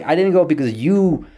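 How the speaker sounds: mildly heated retold accusation; indignant blame. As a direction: turn the blame back insistently. Acted phone quarrel with dad.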